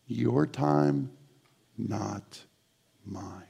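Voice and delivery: soft, slow, fading prayer; slow whispered-down prayer; quiet prayer. Shown as a demonstration. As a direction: pray it gently and let it fade out. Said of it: "would you pray that with me".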